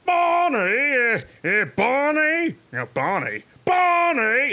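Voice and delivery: high, drawn-out cartoon holler of "Barney"; practicing a Fred Flintstone call. Shown as a demonstration. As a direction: bellow for Barney like a cartoon caveman. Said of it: "learning how to talk like Fred".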